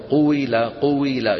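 قويلة with a rounded و and a clear kasra after it; نطق قويلة بواو مكسورة واضحة; demonstrated pronunciation. Shown as a demonstration. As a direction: model قويلة slowly, lips round then jaw drops. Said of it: "كل واحد لحاله يجرب منكم".

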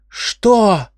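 sudden high, loud yell. A narrator's reading. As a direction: shriek it out in shock. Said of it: "Он завопил на всю камеру".

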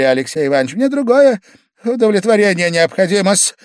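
put-on high, loud drunken pleading; Pavel Pavlovich, agitated. A narrator's reading. Guest demanding some other satisfaction, high and agitated. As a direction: push on excitedly in a high voice.

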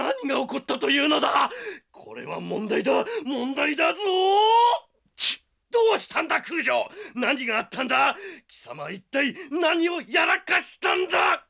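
a frantic teacher losing it; panicking teacher shout. Acted. Teacher yelling at the student over the bloody scene.

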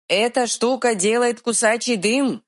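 high shouted warning; shouting. Acted. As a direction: yell the warning. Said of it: "заорал я".

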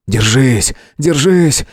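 usual speaking voice

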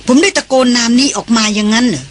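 usual speaking voice

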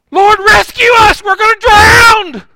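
screaming high and loud, slowly; panicked yell for rescue; terrified shout. Retold or imitated. Disciples in the storm scream to Jesus in terror. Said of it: "Here's what I think the disciples said".

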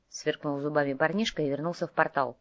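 usual speaking voice

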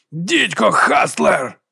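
high, drawn-out cursing cry; high-pitched, stretched yell; shout. A narrator's reading. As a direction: cry it out slowly in alarm. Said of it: "закричав Ендрю".

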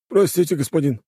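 put-on high, pleading old servant. A narrator's reading. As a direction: beg the master timidly. Слуга поклонился ещё ниже. (робкий голос старого слуги)

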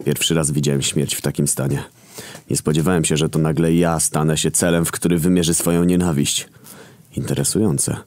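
ordinary delivery

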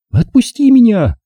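put-on high girlish shout; girl crying out. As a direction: wriggle free, voice high and loud. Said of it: "кричала она".

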